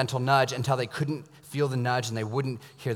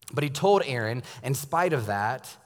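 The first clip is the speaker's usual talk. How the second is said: ordinary talk, nothing marked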